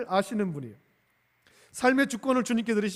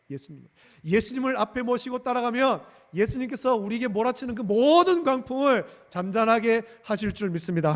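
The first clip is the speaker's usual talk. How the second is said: ordinary talk, nothing marked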